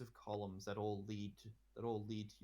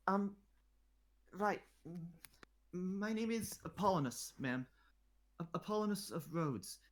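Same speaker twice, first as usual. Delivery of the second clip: lightly voiced boy, higher, stammering; stuttering boy; 9 semitones higher, 5 dB louder than usual. Boy stammers out his name to the queen, lightly voiced and nervous. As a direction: trip over the words nervously. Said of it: "he tells you in a stuttered mess".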